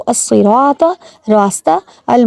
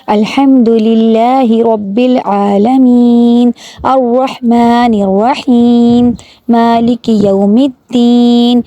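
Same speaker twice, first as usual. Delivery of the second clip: slow, melodic tajweed recitation; slow Arabic recitation; Quran recitation; much slower than usual. Acted. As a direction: chant each verse slowly and reverently. Recites Fatiha in full.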